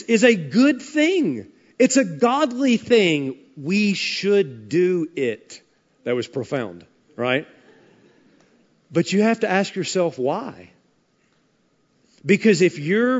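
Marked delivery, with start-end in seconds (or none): none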